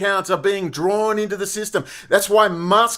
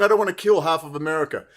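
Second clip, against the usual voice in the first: lower, quicker, softer mock quote; mock Trump; 5 semitones lower, 4 dB quieter, much faster than usual. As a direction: toss off a quick Trump line. Quoting Trump mockingly.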